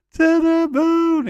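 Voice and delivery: "To the moon" squealed very high and loud, slow; to the moon cry. Pretend. Mocking a wrestler's rocket rise, high slow squeal. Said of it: "He's gone to the moon".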